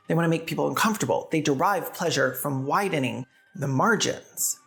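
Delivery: normal talking voice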